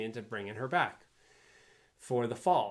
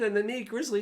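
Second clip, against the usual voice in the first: angry yell; 12 semitones higher, 7 dB louder than usual. Retold or imitated. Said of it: "yelling and screaming".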